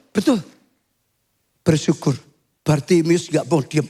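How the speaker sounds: ordinary delivery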